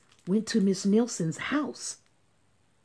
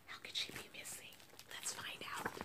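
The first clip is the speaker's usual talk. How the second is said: hushed secretive whisper; 18 dB quieter, much faster than usual. Shown as a demonstration. Teacher whispering a teaser to her listening class. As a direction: lean in and whisper it. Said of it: "teacher whispers to class".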